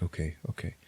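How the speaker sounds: soft subdued agreement; hushed and shaken; quiet. Retold or imitated. Accepting dying captain's order.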